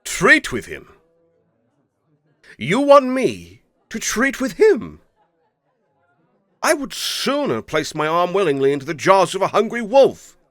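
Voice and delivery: incredulous, high and loud, then a flat refusal; the daughter flaring up at her father's plan; outraged daughter. Acted. Daughter refusing her father, outraged and indignant.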